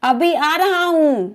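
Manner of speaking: ordinary talk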